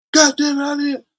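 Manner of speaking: high mock yell in the ear; drill sergeant yelling. Drill sergeant yelling at the recruit in line, high mock yell. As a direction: bark it like a drill instructor. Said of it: "yelling in my motherfucking ear".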